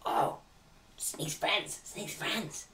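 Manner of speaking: a goblin hushed by recognition; awed goblin. Acted. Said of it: "the goblins are like".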